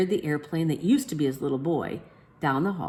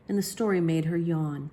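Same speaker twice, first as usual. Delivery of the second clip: ordinary delivery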